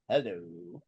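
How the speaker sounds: very low, drawn-out suggestive "hello"; Sulu's suggestive hello. Retold or imitated. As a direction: purr the greeting low and slow. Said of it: "Mister Sulu goes".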